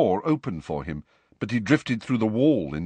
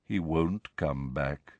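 low, slow, soft verdict; mournful; 6 semitones lower, 5 dB quieter, slower than usual. A narrator's reading. Nick tells Harry there is no return, mournful. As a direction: break bad news slowly and low. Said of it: "looked mournfully at Harry".

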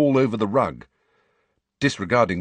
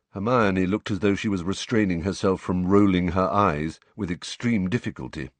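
usual speaking voice

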